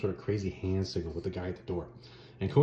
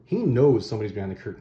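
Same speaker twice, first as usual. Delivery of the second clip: ordinary delivery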